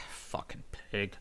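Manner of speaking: very soft, slow whisper; muttered aside. Acted. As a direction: whisper the insult under your breath. Insulting aside under the breath.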